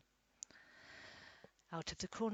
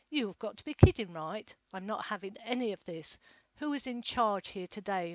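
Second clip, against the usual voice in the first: loud, fast, furious demand; angry demand; 10 dB louder than usual. A narrator's reading. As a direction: demand it loudly in outrage. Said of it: "sounding very angry".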